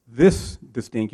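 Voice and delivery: over-deliberate, slow delivery; slow and distinct. Shown as a demonstration. Coach showing a handover, said very slowly. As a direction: stretch each word out slowly. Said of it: "You can just slow it down".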